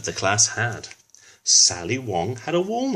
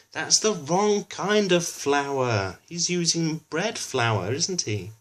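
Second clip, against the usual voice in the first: slow, higher, exasperated teacher voice; 7 semitones higher, slower than usual. A narrator's reading. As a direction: spell out the mistake slowly. Teacher corrects Finley.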